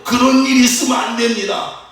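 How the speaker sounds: high-pitched acted protest; flabbergasted defiance. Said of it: "황당해가지고 막 대드는". (황당해서 대드는 높은 목소리)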